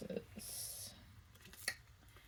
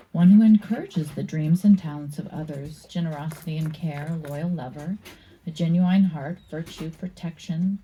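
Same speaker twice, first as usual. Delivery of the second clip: louder, measured reading of the card text; reading aloud; 5 dB louder than usual. Acted. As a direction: read the meaning like a book entry. Reads card meaning aloud.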